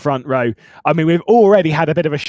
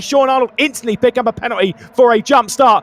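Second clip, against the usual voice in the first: raised, energetic race play-by-play; motorsport commentator; 9 semitones higher than usual. Acted. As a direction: call the race start live and excited.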